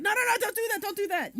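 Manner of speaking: high-pitched imitation of being told off; retold frantic scolding; mock scolding. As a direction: imitate someone mad, pitch jumping up. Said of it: "you were mad at me".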